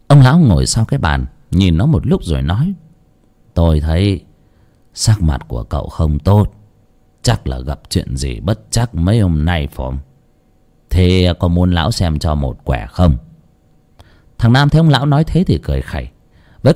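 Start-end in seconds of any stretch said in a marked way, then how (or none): 3.5-13.2 put-on a grave old soothsayer sizing him up; old fortune teller. A narrator's reading. Ông thầy bói nói. (giọng ông lão trầm, chậm rãi)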